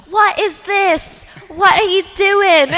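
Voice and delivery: loud, high, drawn-out mock outrage; hammed-up disbelief, pitched high; indignant outburst. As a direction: imitate someone appalled, stretching each word. Retells Nick's indignant complaint about song.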